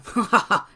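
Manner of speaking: short hearty laugh. A narrator's reading. Anders laughing at Trull's refusal. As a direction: laugh at him. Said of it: "Anders börjar skratta".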